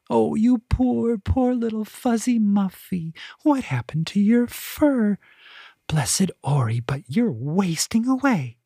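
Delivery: put-on very high, cooing old lady; elderly woman cooing over a stray cat, very high; trilling old woman. A narrator's reading. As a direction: trill like a doting grandmother.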